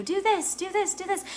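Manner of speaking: small, high voice nudging gently; high-pitched, insistent little inner voice; whispering intuition. Acted. Intuition nudging someone toward action, a high little whispery voice. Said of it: "been whispering to you".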